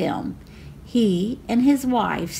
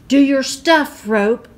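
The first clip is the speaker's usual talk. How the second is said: loud, higher call to the rope; bold command; 5 semitones higher, 6 dB louder than usual. A narrator's reading. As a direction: urge the lasso on. Juanita commands her lasso.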